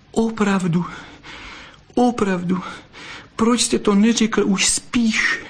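blurted, tearful. A narrator's reading. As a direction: blurt it out through tears of joy. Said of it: "vyhrkla a utírala si čumáček".

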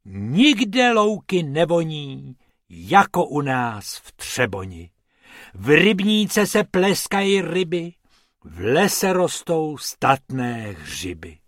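slow, declaimed little poem; measured poetic declamation; poem recital. A narrator's reading. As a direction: recite it with drawn-out line endings. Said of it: "pronesl báseň".

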